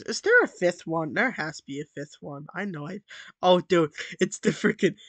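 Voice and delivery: ordinary delivery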